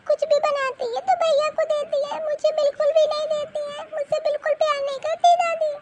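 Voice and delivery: put-on high child voice, crying while complaining; a hurt girl sobbing out everything to her mother; tearful little girl. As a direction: daughter crying to mama about brother and grandma.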